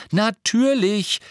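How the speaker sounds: high, drawn-out cry of realisation; exclaiming. A narrator's reading. Hermine suddenly understands, exclaiming. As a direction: call it out slowly as it dawns. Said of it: "rief Hermine".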